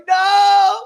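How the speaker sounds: put-on high-pitched panicked kid wail; hammed-up terrified howl; mock screaming. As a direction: scream it in comic desperation. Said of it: "I'm screaming at the top of my lungs".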